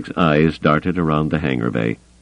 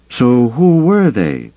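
raised, high and drawn-out call; calling after someone; 9 semitones higher, 5 dB louder, slower than usual. A narrator's reading. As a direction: call it out after a departing man. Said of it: "Mazik called after him".

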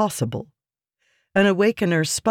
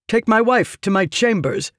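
raised, firm order; commanding; 6 semitones higher than usual. A narrator's reading. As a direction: bark the order to the guards. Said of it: "he commanded".

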